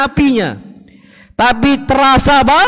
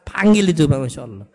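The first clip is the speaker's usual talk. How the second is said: usual speaking voice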